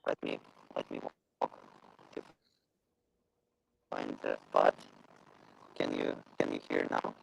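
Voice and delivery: crackling, cutting out; garbled call audio; bad connection. Real, not acted. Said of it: "your voice is, uh, crackling".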